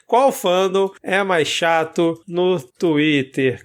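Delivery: slow, word-by-word dictation; drawn-out typing pace; dictating while typing. Real, not acted. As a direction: repeat it slowly as you type it out. Dictating to himself while typing a poll.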